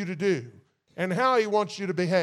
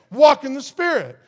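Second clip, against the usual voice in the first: Walk in the Spirit proclaimed much louder and higher, punching walk; loud preached command; 5 semitones higher, 8 dB louder than usual. Shown as a demonstration. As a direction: hammer the command home to the congregation.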